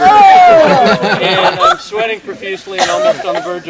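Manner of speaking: high, loud laughing words; cracking up mid-sentence; barely holding it together with laughter; laughing hard. Real, not acted. Laughing through embarrassing story.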